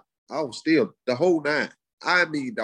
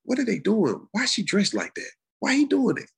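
incredulous inner voice; 8 semitones higher than usual. Retold or imitated. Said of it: "My mind saying this now".

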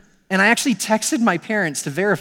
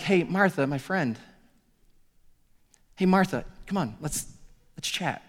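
soft, friendly and intimate; gentle invitation; 7 dB quieter than usual. Retold or imitated. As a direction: invite a tired friend to sit down. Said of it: "which is a way of".

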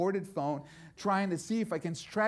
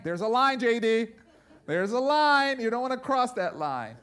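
louder, higher preaching emphasis on line; emphatic warning; 6 semitones higher, 7 dB louder than usual. Real, not acted. Preacher warning the listener, loud and emphatic. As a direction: drive home the line. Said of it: "I learned my lesson".

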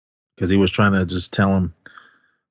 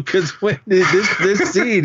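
words broken by real laughter, higher and slower; laughing; 9 semitones higher, slower than usual. Real, not acted. Podcaster recalling a funny scene to his co-host, laughing.

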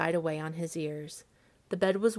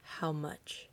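soft, low-key horrified question; dry-lipped, barely voiced; 3 semitones lower, 8 dB quieter than usual. A narrator's reading. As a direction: ask it softly through dry lips. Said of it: "His dry lips shaped the words".

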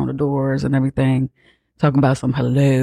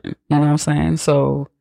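usual speaking voice

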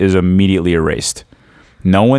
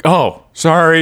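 mock sheepish sorry; 6 semitones higher, slower than usual. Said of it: "You're like".

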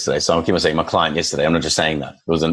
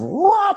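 imitated excited dog noise. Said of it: "stop his momentum of".

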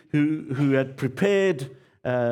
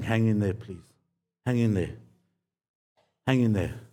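gentle plea; 5 semitones lower than usual. Real, not acted. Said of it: "pleading repeatedly".

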